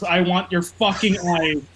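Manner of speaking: usual speaking voice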